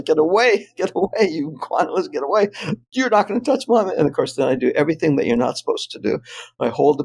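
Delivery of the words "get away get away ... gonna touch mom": higher, hammed-up warding off; mock-heroic command, pitched up; mock defiance. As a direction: act the brave protector, half laughing. Acted standoff protecting his family.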